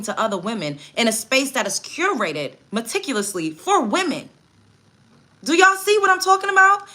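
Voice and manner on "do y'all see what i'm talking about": high, loud appeal to the chat; rally the viewers, voice up high and loud; indignant raised voice at the audience; outraged call out. Real, not acted.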